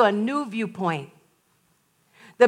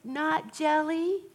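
put-on small child voice; 4 semitones higher, 9 dB quieter, much slower than usual. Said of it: "the child said".

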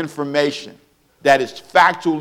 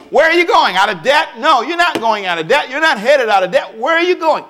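preaching at full force; 7 semitones higher than usual. Acted. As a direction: hammer the question at the congregation.

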